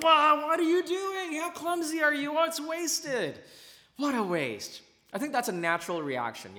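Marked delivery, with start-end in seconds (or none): 0.0-3.4 higher, louder, scolding crowd voice; hammed-up tut-tutting from the guests at a toast; mock disapproving onlookers. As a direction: cluck at a clumsy pourer like a shocked crowd. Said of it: "everyone's looking at you like".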